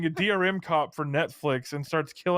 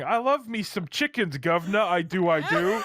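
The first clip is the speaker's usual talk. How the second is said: mock Cockney Milo. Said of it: "starts killing and farting and going".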